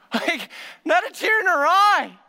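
high, loud, laughing disbelief; cracking up at how stone cold she was; amused disbelief. Real, not acted. Pastor tells congregation about his bride, laughing disbelief. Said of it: "amused disbelief at stone-cold bride".

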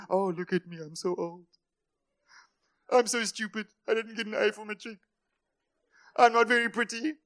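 a moping, self-pitying voice; self-pity whine. Retold or imitated. Preacher mimicking a self-pitying believer, moping whine. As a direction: mope like someone who feels useless.

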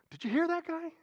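high, amazed voice to the disciples; a light, high, astonished aside to friends; playful Jesus aside. Retold or imitated. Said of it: "Jesus turns to his disciples and he goes".

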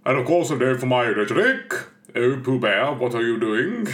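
a hint of a fussy cartoon owl.

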